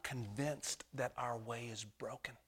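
hushed and slow; slow whisper. Acted. Preacher confessing to the church in a slow whisper. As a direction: let it fall to a whisper.

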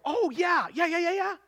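high, excited burst of remembering; light up with recognition, pitch jumping; acted sudden recognition. Preacher suddenly remembering the girl's story, excited recognition.

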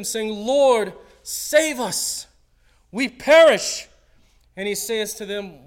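loud, high dramatic scripture reading; preaching scripture. Acted. Pastor reading the storm story to the church, dramatic. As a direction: read it like a storm is raging.

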